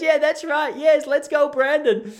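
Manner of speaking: high, loud, bright-eyed reporter cover-up; upbeat reporter. Retold or imitated. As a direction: chirp it out like a reporter saving face. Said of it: "And she goes".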